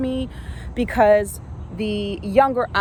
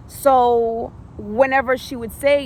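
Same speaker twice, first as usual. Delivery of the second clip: ordinary talk, nothing marked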